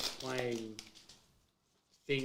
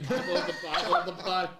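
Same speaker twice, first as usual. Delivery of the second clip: excited laughing; 7 semitones higher, 7 dB louder than usual. Real, not acted. Excited funny retelling.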